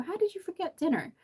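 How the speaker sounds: high, disbelieving mimicked question; mock incredulous. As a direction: retell it in a high, astonished voice. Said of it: "when I say that to people, they go".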